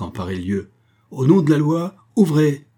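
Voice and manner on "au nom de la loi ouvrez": loud, high, rough command through a door; rough shout. A narrator's reading. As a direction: bark it like a policeman at the door. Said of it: "une voix rude retentit". (crié d'une voix rude)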